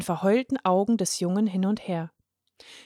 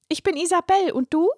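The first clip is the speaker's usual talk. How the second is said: put-on high, bright-eyed girl's voice; a small girl introducing herself, pitched up; young girl, chirpy and friendly; cheerful little girl; 9 semitones higher, 3 dB louder than usual. A narrator's reading.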